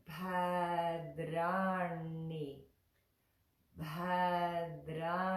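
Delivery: "bhadrani" drawn out syllable by syllable; pronunciation demo. Shown as a demonstration. Teacher showing the tricky third line, drawn-out "bhadrani". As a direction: sound out "bhadrani" slowly and fully. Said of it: "this is the tricky one".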